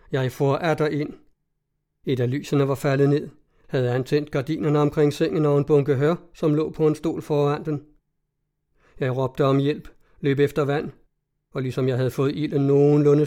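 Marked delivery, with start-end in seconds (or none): none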